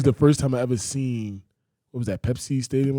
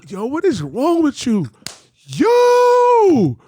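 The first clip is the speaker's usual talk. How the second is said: high retold shout, building to a long YO; mock outraged yell; 6 dB louder, much slower than usual. Friend retelling a confrontation, high mock outrage. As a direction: build from high protest to a drawn-out holler.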